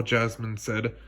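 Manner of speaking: ordinary delivery, nothing marked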